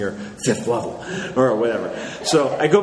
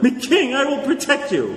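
much higher hammed-up hero voice; comic dwarf hero; 11 semitones higher than usual. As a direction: promise protection like a dim dwarf. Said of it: "And I'm like".

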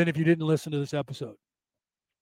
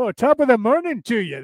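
higher, louder mocking stage-Irish "top of the morning"; 6 semitones higher, 5 dB louder than usual. Host mocking the Irish cliché with a fake Irish accent. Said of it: "it's that bullshit Irish".

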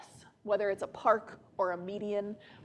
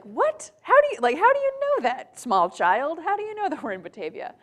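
high, loud, amazed questioning; a father floored by his small child's remark; dad's astonishment; 8 semitones higher, 7 dB louder than usual. Retold or imitated. Dad amazed at his small son. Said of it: "my dad was like".

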